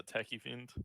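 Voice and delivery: usual speaking voice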